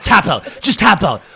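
loud, urgent mock yell; shouted demand. Fighter orders his pinned friend to quit, shouting. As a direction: shout it as if holding a headlock.

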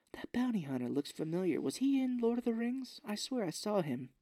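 lightly put-on puzzled heroine; mock Korra voice. Recapper voicing heroine spotting a familiar face, mock puzzled.

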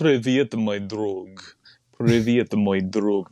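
Russian phrase in a put-on Russian accent. Shown as a demonstration. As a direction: greet in Russian, accent laid on. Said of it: "I would say, like".

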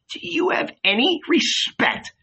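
high-pitched indignant question; indignant rebuke. A narrator's reading. Peter rebuking Judas for the rabbi, high indignation. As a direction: demand respect in a high voice.

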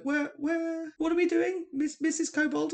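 mock-plaintive players calling out for their guide; party's voice. Players asking the kobold for directions, high mimicking voice. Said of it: "So they'd be like".

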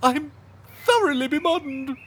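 high, loud whimpering fluster. Acted. Unhorsed servant whimpers, high and flustered. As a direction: whine it, all dignity gone.

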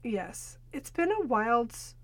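normal talking voice

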